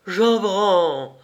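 high surprised exclamation of a name; father and son recognising Jean Brand; surprised cry. A narrator's reading. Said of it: "s'écrièrent en même temps".

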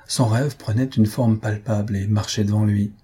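ordinary delivery

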